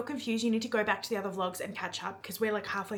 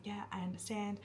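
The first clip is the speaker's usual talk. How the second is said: soft, much quieter answer; quiet reply; 11 dB quieter than usual. Retold or imitated. She answers him up close, very quietly. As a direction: say it barely above a breath. Intimate scene retold.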